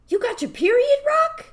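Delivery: high, loud, hammed-up dismayed voice; imitated upset dad. Dad overhearing daughter, high mock-dismayed voice. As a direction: squeak it out as a crestfallen dad. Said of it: "he just seemed so sad".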